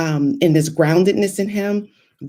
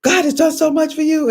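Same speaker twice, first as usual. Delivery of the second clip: dad raising his voice; 8 semitones higher than usual. Retold or imitated. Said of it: "raised his voice at me".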